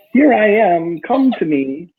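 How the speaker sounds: high mock shout, falling off at the end; shouting to universe. Driving to see dad, shouting to the universe, high mock call. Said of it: "I'm shouting to the universe".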